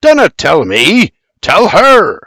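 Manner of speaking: a hint of a gruff father's bark. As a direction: point and order him. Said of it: "Bibi barks".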